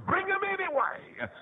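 high, drawn-out preacher's cry; insistent exhortation. Real, not acted. Preacher answering parents' excuses about church, high insistent cry. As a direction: urge it in a stretched high call.

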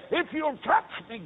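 high, slow preacher's plea; fervent rising appeal; building plea. Retold or imitated. Vow building to a climax.

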